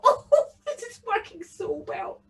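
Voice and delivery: a bogle delighted his trick works; gloating bogle. Acted. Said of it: "the bogle thought".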